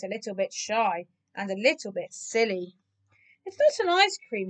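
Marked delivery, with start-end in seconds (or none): 3.4-4.5 high, squeaked protest; squeaky. A narrator's reading. Keith denying the ice cream to the cats, squeaky. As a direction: squeak it out indignantly. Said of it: "he squeaked".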